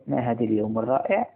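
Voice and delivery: usual speaking voice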